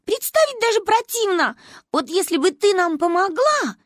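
put-on high little girl's voice; high childish protest; disgusted, pleading child. A narrator's reading. Child disgusted, begs grandma's help.

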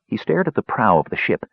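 usual speaking voice

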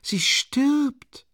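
high, alarmed bystander cry; cry from the crowd. A narrator's reading. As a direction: call out in alarm. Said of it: "rief jemand".

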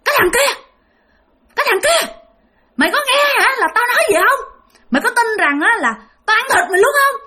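very high, threatening ghostly screech; shrill ghost woman. Acted. Ghost woman on the boat threatening to eat the fisherman, shrill and menacing. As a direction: menace in a piercing, creepy woman's voice. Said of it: "một cái giọng bà the thé rất là ghê".

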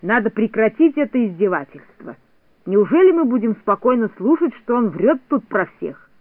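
usual speaking voice

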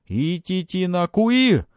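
high, slow, loud chant-like cry. A narrator's reading. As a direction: bellow it like a shaman.

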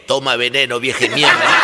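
put-on low slurred drunk retort; drunk grumble. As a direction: snarl it like a drunk. Drunk insults an old lady. (respuesta baja y arrastrada de borracho)

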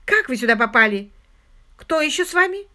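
loud, sharp demands; angry interrogation. A narrator's reading. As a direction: fire the questions at an intruder. Hostess angrily interrogates intruder.

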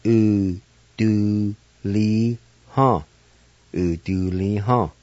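U, do, li, ha broken into separate syllables; teacher sounding out syllables. Shown as a demonstration. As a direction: split U-do-li-ha into beats. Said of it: "And it's pronounced like this".

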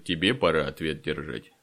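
low, scornful retort; mocking comeback. A narrator's reading. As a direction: throw the threat back, leaning on «тебе». Fyodor throws the words back.

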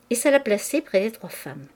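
plain reading voice

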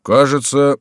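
drawn-out, musing word; philosophical remark. A narrator's reading. Guard captain reflecting on the blunder, philosophically. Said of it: "философски заметил начальник караула".

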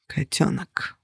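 low, very soft, breaking. A narrator's reading. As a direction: let the word crack. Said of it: "Его голос прервался".

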